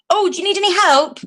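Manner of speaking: high-pitched, quick offer of help; eager helper. Retold or imitated. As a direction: imitate an over-keen passer-by. Voices eager woman offering help.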